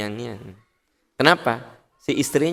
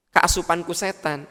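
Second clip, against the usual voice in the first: usual speaking voice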